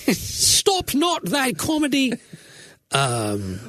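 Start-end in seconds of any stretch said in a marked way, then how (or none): 0.1-2.1 high, slow singsong British voice; hammed-up British accent, drawn out high; mock British accent. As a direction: intone it like archaic British verse. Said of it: "speaking in British accent".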